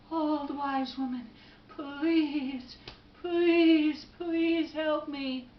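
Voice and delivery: beg, stretching every please; acted desperate plea; pleading. Man begs wise woman for help.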